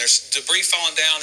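usual speaking voice

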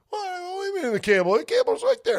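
put-on very high, fast, confused old-man squeak; squeaky high indignant dad. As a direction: play the baffled father, pitched far up. Dad's reply voiced in story.

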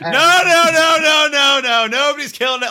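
loud, high repeated no's, easing down; breaking up a fight. Retold or imitated. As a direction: shout them apart, then calm it. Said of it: "And I'm like".

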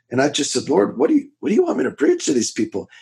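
ordinary talk, nothing marked

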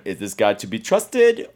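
ordinary talk, nothing marked

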